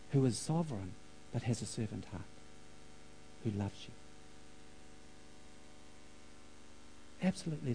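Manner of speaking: hushed, low, with long pauses; soft and low, letting each thought settle; tender preaching. Real, not acted. Preacher telling listeners that God loves them, hushed and tender.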